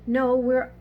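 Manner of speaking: hasty protest. A narrator's reading. Polly denying to the animals that they're lettuce, hasty protest. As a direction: blurt it out in protest. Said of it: "said Polly hastily".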